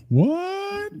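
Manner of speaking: very high, long rising "what"; sudden piping up. Retold or imitated. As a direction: swoop up into a squeak, imitating. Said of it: "I just pipe up".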